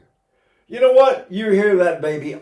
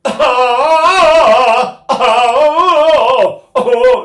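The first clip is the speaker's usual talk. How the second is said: put-on loud, high sustained baby wail; full-blast singer's imitation of a crying baby; baby sound; 10 semitones higher, 12 dB louder than usual. Shown as a demonstration. Singer showing the best carrying sound, loud baby wail. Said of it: "the best carrying sound is still the baby sound".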